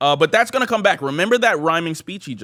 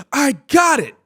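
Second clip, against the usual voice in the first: high mock eureka cry; sudden realization; 9 semitones higher, 4 dB louder than usual. Three friends getting an idea at once, high hammed-up exclamation. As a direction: burst out as if the idea just struck. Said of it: "at the same time go".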